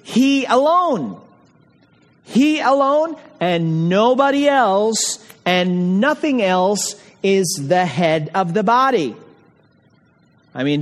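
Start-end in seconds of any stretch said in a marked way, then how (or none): 0.0-9.1 deliberate, weighty stress on "he alone"; emphatic HE. Shown as a demonstration. Preacher excluding any other head of the church, emphatic HE. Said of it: "There is an emphatic sense in the word he".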